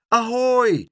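high, loud answering hail; calling back. A narrator's reading. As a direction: holler back across a distance. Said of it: "riep Pluk terug". (luid en hoog terugroepen)

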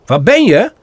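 a fawn searching in the snow; calling out. Acted. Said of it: "vraagt Bambi".

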